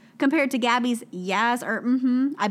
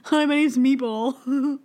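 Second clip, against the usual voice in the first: slow, put-on goofy introduction; imagined Meatball introduction; much slower than usual. Imagining the contestant introducing himself, mock slow introduction. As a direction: drag out a silly self-introduction. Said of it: "that's what I'm imagining".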